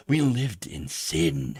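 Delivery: slow, drawn-out mock confession; mock-scandalized. Host jokingly confesses living with future wife, mock-scandalized. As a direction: confess it with hammed-up horror.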